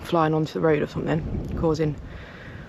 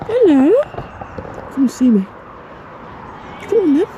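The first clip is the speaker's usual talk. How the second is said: high coaxing pet-talk; 8 semitones higher than usual. Real, not acted. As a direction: coax the animal over with rising hellos. Coaxing sheep to come closer.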